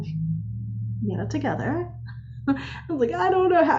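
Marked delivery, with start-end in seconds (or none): 0.0-1.9 a hint of a steady, no-nonsense husband; husband's calm rebuke. Husband telling his tired wife, slow and soft. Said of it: "He's like". 3.1-3.8 high, plaintive near-tears; tearful. Retold or imitated. Answering her husband, high and tearful. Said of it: "then I just started crying".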